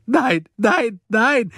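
high, loud repeated wail; sobbing. A narrator's reading. Woman pleading against the vote, sobbing. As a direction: wail each word in despair. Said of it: "schluchzte Silje".

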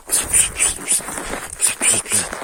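hushed calling of the name; softly calling the dog over in a whisper; soft coaxing whisper. Real, not acted.

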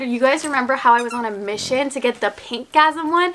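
ordinary talk

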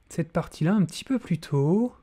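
normal talking voice

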